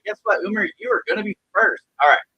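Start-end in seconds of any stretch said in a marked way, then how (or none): none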